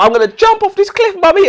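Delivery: squeaky high mock kid, loud; mock child voice. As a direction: play the whiny child about to jump. Child voiced in retold story.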